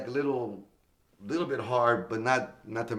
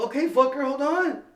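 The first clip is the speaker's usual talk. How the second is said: higher and louder, cocky and defiant; brash retort shouted up high; cocky comeback; 7 dB louder than usual. Retold or imitated. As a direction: snap back at the host like you own the stage. Grabbing the mic on stage.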